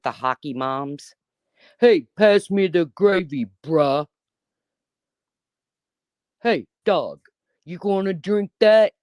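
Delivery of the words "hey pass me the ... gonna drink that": hammed-up thug boy asking for gravy; mock thug at a luncheon. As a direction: imitate her as a teen thug at a luncheon. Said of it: "That's what you fucking sound like".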